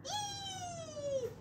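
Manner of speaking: put-on very high, soft baby squeal; tiny happy shriek. A narrator's reading. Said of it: "Irma Jean shrieked happily".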